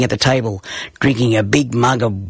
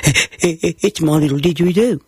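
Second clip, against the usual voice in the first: nervous answer; 4 semitones higher than usual. A narrator's reading. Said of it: "I nervously answered".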